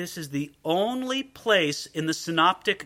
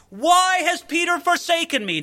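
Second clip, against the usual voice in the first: high, louder pretend outcry; mock cry; 10 semitones higher, 4 dB louder than usual. Preacher posing a cry Jesus never made, high and raised. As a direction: toss out the wrong cry as a hypothetical. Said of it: "does he cry out".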